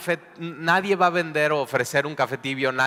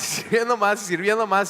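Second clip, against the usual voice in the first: laughing; 8 semitones higher than usual. Acted. Mocking refills, laughs heard.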